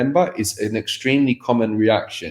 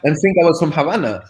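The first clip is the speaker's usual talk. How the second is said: laughing; 6 semitones higher than usual. Real, not acted. Laughing while telling of being taken for a local. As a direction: let the laugh lift and stretch the words.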